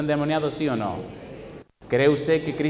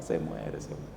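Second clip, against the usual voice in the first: murmured lament; 13 semitones lower, 12 dB quieter, slower than usual. Retold or imitated. Repeated lament, very low.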